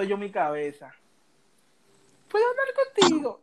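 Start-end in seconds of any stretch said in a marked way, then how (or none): 2.3-3.4 much higher, girlish put-on voice; mimicking a girl's voice. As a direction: squeak it out like the girl he liked. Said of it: "escucho una voz que me dice".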